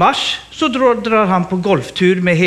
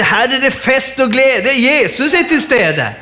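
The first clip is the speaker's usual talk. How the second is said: building preacher's fervour; jubilant proclamation; 4 semitones higher, 3 dB louder, slower than usual. Real, not acted. Preacher celebrating at communion, jubilantly proclaiming. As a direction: proclaim it, stretching out the final phrase.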